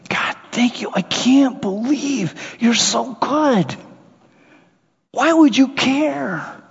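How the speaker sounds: grateful prayer. Acted. Praying in grateful wonder.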